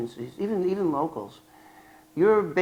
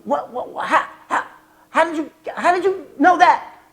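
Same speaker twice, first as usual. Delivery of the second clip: stammering, rising to a high, louder pitch; shocked agent; 12 semitones higher, 5 dB louder than usual. Retold or imitated. Federal agent stunned by informant's tip, stammering high. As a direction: sputter in disbelief, climbing high.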